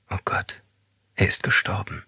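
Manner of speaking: breathy whispered despair; a frightened woman alone in the dark, whispering; tearful whisper. A narrator's reading. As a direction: whisper it through tears, barely audible. Grieving woman whispers to herself.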